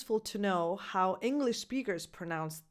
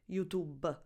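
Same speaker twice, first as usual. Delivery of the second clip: softer Italian-accented "YouTube" with added schwa; 2 semitones lower, 5 dB quieter than usual. Shown as a demonstration. Teacher repeating the mistake, Italian-accented "YouTube-uh". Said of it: "with an extra schwa sound at the end".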